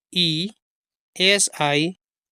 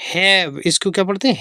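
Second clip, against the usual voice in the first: ordinary talk, nothing marked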